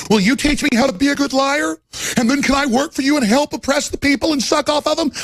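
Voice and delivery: high mock fawning student voice. Host mocking grad student begging elites, high fawning voice. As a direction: simper up at the elites, pitched high. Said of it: "fawn after the elites and".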